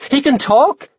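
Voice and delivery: high, disbelieving question; startled, rising question; astonished. A narrator's reading. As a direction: blurt it in high-pitched surprise. Carl shocked the ghost talks.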